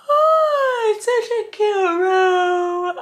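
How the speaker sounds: high, drawn-out mock wail; tearful whine. Real, not acted. Overtired vlogger in a cute room, wailing whine. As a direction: whine it high and slowly, stretching the vowels.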